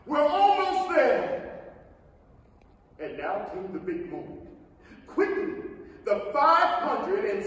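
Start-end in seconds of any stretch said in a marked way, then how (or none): 0.0-1.7 high, loud and slow; eager shout. Acted. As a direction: call out with hope. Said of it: "shouted the old green grasshopper".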